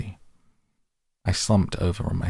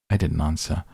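usual speaking voice

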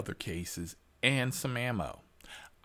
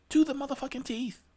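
"motherfucking teeth" much higher and fast; emphatic outburst; 5 dB louder, faster than usual. Shown as a demonstration. Repeating the point to followers even harder, high outburst. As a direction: fire off "To the motherfucking teeth" as a peak.

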